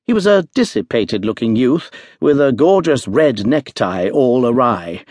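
plain reading voice

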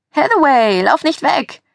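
high singsong call; teasing singsong. A narrator's reading. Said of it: "hörte ich einen bekannten Singsang".

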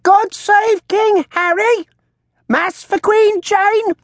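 very high, slow parrot squawk; talking bird. A narrator's reading. Bird mimicking the old woman, shrill squawk. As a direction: squawk it back like a shrill crone. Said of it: "in a voice very like the woman's own".

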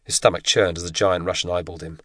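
plain reading voice

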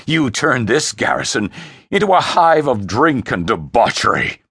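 scornful, deliberate dressing-down; dripping disgust. Acted. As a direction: berate your son with disgust, building in force. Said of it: "Frey's voice dripped disgust".

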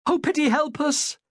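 high-pitched plea for help; high, pleading cry; desperate prayer. A narrator's reading. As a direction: pray aloud in distress. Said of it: "Sarah prayed".

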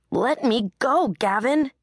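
angry shout. A narrator's reading. Heroine struggling, shouting at him.

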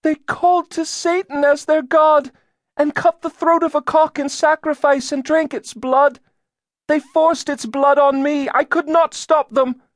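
put-on very high, loud accusing girl's voice; entranced girl. A narrator's reading. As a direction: testify in a trance, high-pitched throughout. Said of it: "She spoke as if in a trance".

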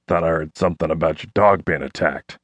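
burly shop teacher; gruff man. A narrator's reading. Burly Carl speaks.

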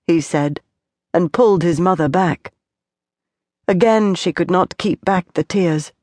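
usual speaking voice

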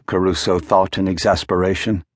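usual speaking voice

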